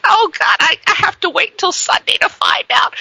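high-pitched, put-upon retelling; mock dismay. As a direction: wail it in mild mock despair. Retelling own exasperated reply.

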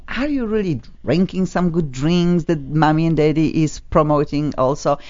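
usual speaking voice

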